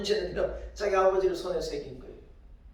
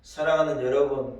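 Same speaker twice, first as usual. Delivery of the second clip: ordinary delivery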